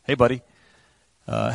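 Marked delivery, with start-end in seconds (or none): 0.0-0.4 mock casual greeting. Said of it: "He's not going".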